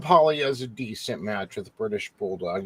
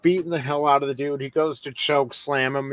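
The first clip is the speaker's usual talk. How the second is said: usual speaking voice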